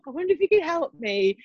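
a touch of prim phone voice; polite caller. Retold or imitated. Retelling the call again, mildly prim. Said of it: "calling a fucking operator".

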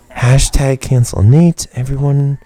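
loud, slow slogan; mock announcement. Host rallying listeners against co-host, loud mock announcement. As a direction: proclaim it loudly to everyone.

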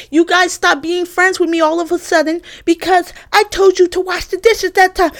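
a hint of her complaining tone; mocking roommate. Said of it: "she was like".

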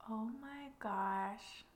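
hushed awed whisper. Real, not acted. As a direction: breathe it out in awe. Awed speculation about plot twist.

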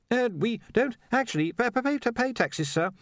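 high, stuttering correction; hesitant underling. A narrator's reading. Ponder corrects the Arch-Chancellor about taxes, stammering. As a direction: stammer it out deferentially.

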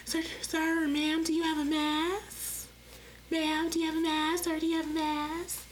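the polite store clerk asking customers about masks, in a sweet professional voice. Retold or imitated. Chirpy retail politeness. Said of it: "I gotta put on my professional voice".

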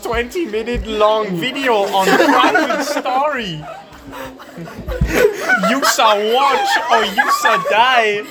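high, slow laughing alien voice; hammy Gungan threat, half shouted; Jar Jar voice. Pretend. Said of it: "Go on, do it".

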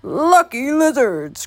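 put-on high, slow old man's croak. A narrator's reading. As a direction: croak it out slowly like an old man. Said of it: "croaked the old man".

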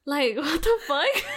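high, loud, laughing disbelief. Real, not acted. Laughing at the memory.

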